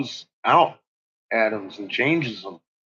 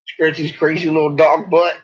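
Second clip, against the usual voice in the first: ordinary delivery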